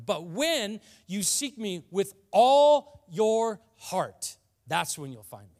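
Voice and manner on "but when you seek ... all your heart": all your stretched slowly and pushed much louder; slow, loud preacher's stress on all. Shown as a demonstration. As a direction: lean hard into all, drawing it out. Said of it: "The word all is so important there".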